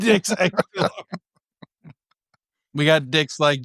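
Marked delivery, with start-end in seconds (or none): 0.0-0.9 laughing. Real, not acted. Laughing repeat.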